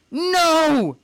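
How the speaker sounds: very high, loud, drawn-out cry; anguished scream. Acted. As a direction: scream it out in horror and grief. Son watches mother vanish.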